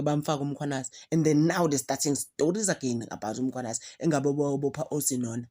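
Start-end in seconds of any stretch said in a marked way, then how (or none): none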